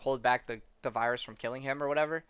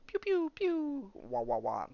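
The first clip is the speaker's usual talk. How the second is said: mock blaster sounds; 11 semitones higher, slower than usual. Imitating blaster fight noises.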